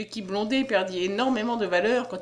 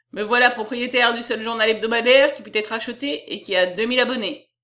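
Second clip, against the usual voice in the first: louder, bluff bookseller announcing his news; puffed-up businessman greeting his cronies; jovial self-important publisher; 2 semitones higher, 5 dB louder than usual. Acted. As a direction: boom it out to the room.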